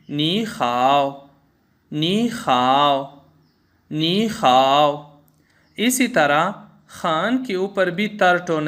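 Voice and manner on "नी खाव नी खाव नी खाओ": नी खाव slowly as ní hǎo, rising ni then a dipping hao; Mandarin nǐ hǎo. Shown as a demonstration. Teacher slowly demonstrates the third tone change in ni hao. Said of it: "मसलन".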